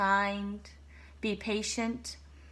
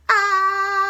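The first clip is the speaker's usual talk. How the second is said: sudden high loud shriek of fright; a startled elephant cries out; startled scream; 12 semitones higher, 14 dB louder than usual. Acted. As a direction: yelp as if something landed on you.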